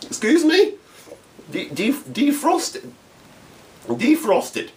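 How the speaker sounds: can't believe what he just heard, slow and high; incredulous disbelief. Retold or imitated. Mocking the gate staff's news about de-icing, stunned high disbelief. Told the plane needs defrosting.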